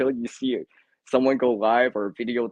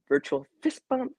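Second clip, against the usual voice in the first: high upbeat. Shown as a demonstration. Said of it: "let me do it like this".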